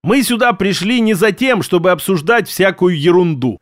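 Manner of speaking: ordinary delivery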